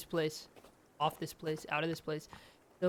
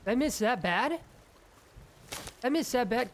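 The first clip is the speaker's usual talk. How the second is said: disbelieving outburst; 7 semitones higher, 4 dB louder than usual. Real, not acted. Missing the deer.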